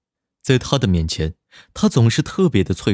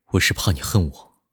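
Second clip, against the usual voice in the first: low, soft and serious; earnest; 5 semitones lower, 5 dB quieter than usual. A narrator's reading. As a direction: say it with full sincerity. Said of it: "十分认真地说道".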